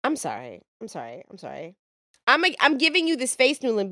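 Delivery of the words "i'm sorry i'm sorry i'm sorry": mock apology. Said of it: "mock apology".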